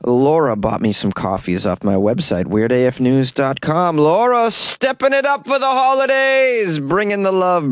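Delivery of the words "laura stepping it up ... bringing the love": hyped-up shout-out. Pretend. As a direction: sing out praise for a donor. Host hypes up a generous donor.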